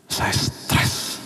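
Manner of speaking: breathless whisper, barely there; whispered despair. Retold or imitated. Preacher recalls his grief after the death, whispering. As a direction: let the words fall away into a whisper.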